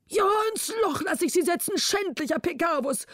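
high, fast, breathless royal scolding; exhausted angry king. A narrator's reading. King out of breath, scolding Pecavus. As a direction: spit it out after the chase. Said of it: "rief der König erschöpft".

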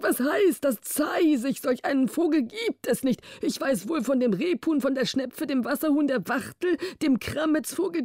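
high-pitched king contradicting the prince; high, huffy storybook royal; indignant, know-all king. A narrator's reading. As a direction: play a naive king insisting he knows his birds. Narrator voices the contradicting king.

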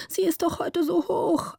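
put-on high-pitched child's voice; little girl calling. A narrator's reading. Gritta protesting the machine's height to her father, calling out. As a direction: plead in a high little girl's voice. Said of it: "rief Gritta".

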